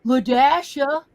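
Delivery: imitated sassy pupil, loud "Duh"; with an attitude. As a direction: blurt a loud "duh", then the name as if obvious. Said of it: "she said it with an attitude".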